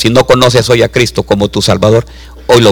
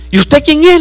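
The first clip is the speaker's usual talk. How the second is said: high, mock-surprised woman's voice; astonished lady. Preacher voicing the amazed lady in a high voice. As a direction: play the amazed señora, pitched well up.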